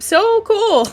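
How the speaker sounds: usual speaking voice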